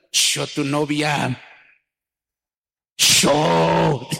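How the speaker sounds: slow, disdainful mock sneer; a hint of a stuck-up girl scoffing; haughty scorn. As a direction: look down on him as you say it. Girl scorning a humble suitor.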